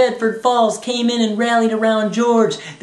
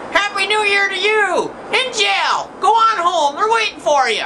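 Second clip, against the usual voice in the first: high, hollered retort; Mister Potter; 9 semitones higher than usual. Acted. Arch enemy Potter answers George, hollered retort. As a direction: call it back at an enemy. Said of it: "And Mister Potter's like".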